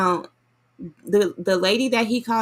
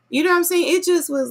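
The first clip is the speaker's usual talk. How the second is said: high indignant outburst; outraged; 11 semitones higher, 4 dB louder, faster than usual. Real, not acted. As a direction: voice jumps up in disbelief. Outraged at the pastor.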